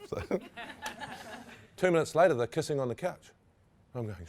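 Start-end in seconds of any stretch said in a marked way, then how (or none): 0.3-1.4 high airy laugh. Real, not acted. Laugh alone on line.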